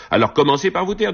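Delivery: high-pitched, indignant comeback; snippy retort. A narrator's reading. Madame Pic rebuking Madame Bellottel, tart retort. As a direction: shut her down, pitched well up. Acted quarrel between two bickering women.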